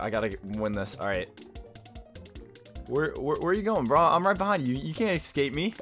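ordinary talk, nothing marked

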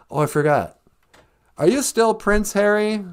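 ordinary talk, nothing marked

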